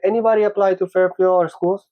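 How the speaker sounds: high, bright calling out to the class; lightly voiced intern asking the room. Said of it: "and she's like".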